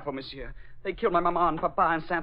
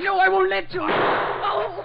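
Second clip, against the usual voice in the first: high, loud frantic cry; boy shouting to save the Hornet; desperate shout; 13 semitones higher, 4 dB louder, much slower than usual. Acted. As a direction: scream to stop the shot. Boy stops the shot.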